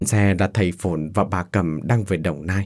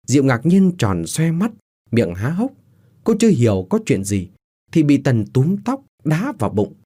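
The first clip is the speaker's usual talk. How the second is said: ordinary delivery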